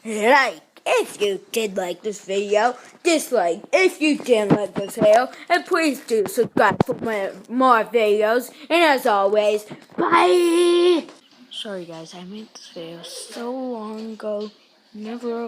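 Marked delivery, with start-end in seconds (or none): 0.1-11.1 loud, upbeat sign-off; excited outro. Real, not acted. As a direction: shout the goodbye to viewers. Energetic vlog sign-off.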